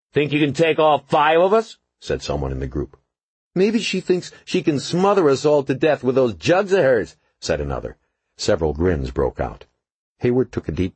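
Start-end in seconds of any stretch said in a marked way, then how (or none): 0.1-1.8 high, loud jeering challenge; taunting thug. A narrator's reading. Gang member taunts cop. 3.5-7.2 high, loud crude taunt; leering jeer. A narrator's reading. Gang member jeers at cop.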